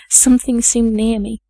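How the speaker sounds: usual speaking voice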